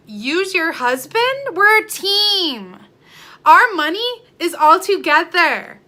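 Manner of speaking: loud, high, drawn-out protest; indignant. Real, not acted. Wife insisting the couple are a team, indignant. Indignant rebuttal of husband accusation.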